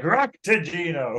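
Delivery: high, drawn-out catchword; mock entrance line, stretched out slowly; Sisko. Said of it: "Sisko just appears and he's like".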